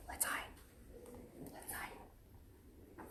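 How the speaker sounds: breathy hiding whisper; playful whispered hiding. Pretend. Hiding from the children.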